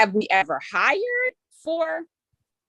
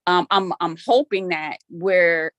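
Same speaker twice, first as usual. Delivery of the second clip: normal talking voice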